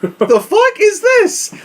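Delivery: high, slow laughing disbelief; incredulous. Real, not acted. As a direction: squeal it out in disbelief.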